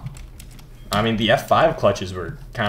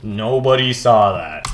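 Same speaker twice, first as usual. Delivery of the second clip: usual speaking voice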